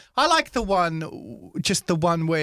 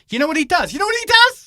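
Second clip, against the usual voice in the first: much higher and louder; rising outrage; 14 semitones higher, 8 dB louder, faster than usual. Real, not acted. Tells his friend about a hated guy, rising outrage. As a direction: build up the gossip. Building indignation.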